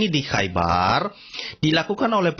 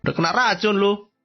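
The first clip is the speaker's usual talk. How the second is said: mocking taunt; 7 semitones higher than usual. Mockers jeering at the poisoned prophet, mocking taunt. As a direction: crow it like a teasing bully. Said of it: "diejek-ejek o-, oleh orang Yahudi".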